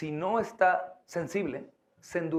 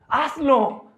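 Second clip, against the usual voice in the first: urging command; 8 semitones higher, 8 dB louder than usual. Shown as a demonstration.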